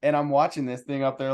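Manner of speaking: usual speaking voice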